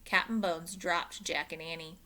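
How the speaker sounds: usual speaking voice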